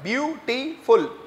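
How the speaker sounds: Beautiful slowly broken into three syllables; split into syllables. Shown as a demonstration. Teacher counting out the syllables, beautiful in slow separate beats. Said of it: "ये syllable कहलाते हैं".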